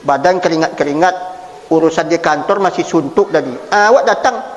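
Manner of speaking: ordinary delivery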